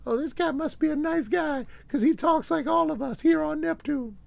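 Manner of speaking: very high falsetto alien; high-pitched mock Neptunian; alien from Neptune. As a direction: speak as an alien in a high put-on voice. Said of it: "on planet Neptune, they're like".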